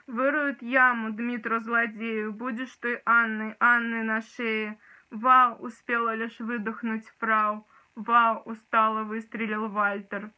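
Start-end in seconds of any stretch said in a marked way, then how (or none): 5.1-5.6 loud exclamation. Acted. Exclaimed quote in poem.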